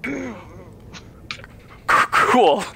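stammered, hesitant single word. Acted. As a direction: stall on the first sound, then get it out. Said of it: "trying to say less".

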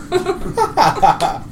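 high-pitched burst of laughter. Real, not acted. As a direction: break up laughing after the line. Laugh after Brandis's blustering speech.

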